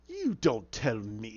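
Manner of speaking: low, slow, drawn-out warning; menacing retort. Retold or imitated. Villain warning the defiant woman, menacing. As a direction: stretch each word as a threat.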